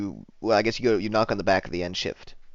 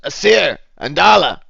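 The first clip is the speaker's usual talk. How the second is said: a tavern keeper bellowing names, louder and higher; 7 semitones higher, 10 dB louder than usual. Acted. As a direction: call out loudly for the kitchen boys. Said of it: "he just bellows".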